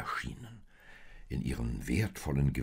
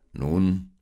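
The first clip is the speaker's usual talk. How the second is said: lightly voiced commanding stranger; a loud, full opening word from a stately traveller; a hint of a deep-voiced king; 3 semitones higher, 9 dB louder than usual. A narrator's reading. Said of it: "fragte dieser mit tiefer Stimme".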